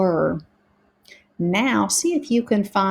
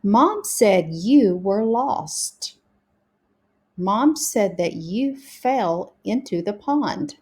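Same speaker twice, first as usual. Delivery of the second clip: slow, careful model sentence reading; putting it together. Shown as a demonstration. Teacher putting sentences all together for pupils, slow model reading. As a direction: read each word distinctly for beginning readers. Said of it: "let's put it all together".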